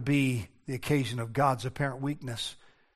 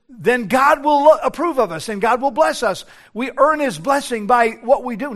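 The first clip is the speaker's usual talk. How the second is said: mock self-righteous; 7 semitones higher, 7 dB louder than usual. Mocking earning God's blessing.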